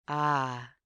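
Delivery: plain reading voice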